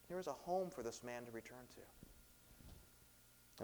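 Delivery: soft and slow, lingering on home; preacher softening as the man finally goes home; tender slow. Real, not acted. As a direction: let the hope sink in. Tender point about homecoming.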